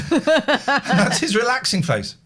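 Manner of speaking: high, drawn-out words through laughter; laughing. Real, not acted. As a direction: crack up while getting it out. Cracks up at own impression of son.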